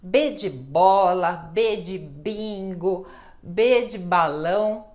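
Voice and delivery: B de bola, B de bingo, B de balão said slowly and clearly; slow clear demo. Shown as a demonstration. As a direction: pronuncie devagar cada palavra com B. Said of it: "letra B". (pronúncia lenta e clara)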